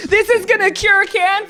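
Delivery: high, gushing fake-earnest commenter; mock commenter. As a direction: parody an over-moved Facebook fan. Mocking gushing Facebook commenter.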